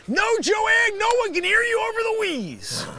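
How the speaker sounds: exasperated high shout; irritated yelling. Acted. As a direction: yell across the office in annoyance. Yelling at coworker across the room.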